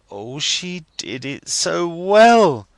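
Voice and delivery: high, slow, rapturous exclamation; sudden ecstasy. A narrator's reading. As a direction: gush with delight. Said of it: "in a sudden ecstasy".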